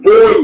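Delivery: বই called out high and loud; emphatic example word. Shown as a demonstration. Teacher stressing example word বই to students, high and loud. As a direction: punch বই as the example. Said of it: "এই যে ঢেউ এবং বই".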